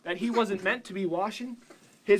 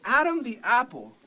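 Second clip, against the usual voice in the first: ordinary delivery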